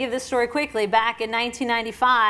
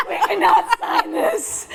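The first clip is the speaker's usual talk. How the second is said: higher, louder, slow, laughing insistence; worked-up protest; 8 semitones higher, 5 dB louder, slower than usual. Retold or imitated. Foudy refusing the contract, slow laughing insistence. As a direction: insist breathlessly through laughter. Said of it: "And I'm hyperventilating".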